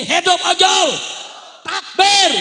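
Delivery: high, very loud crowd shout; full-force pulpit yell; rallying cry. Real, not acted. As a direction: fire up the other side. Rallying other side of crowd.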